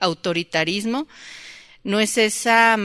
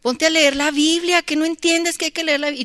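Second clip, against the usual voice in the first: high, louder mock scolding of a child; mother scolding; 9 semitones higher, 3 dB louder than usual. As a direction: snap at the kid to go read the Bible. Mother scolding child about Bible.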